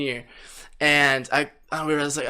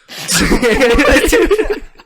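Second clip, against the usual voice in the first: laugh; 9 semitones higher, 7 dB louder than usual. Real, not acted. Laughs at cheeky question.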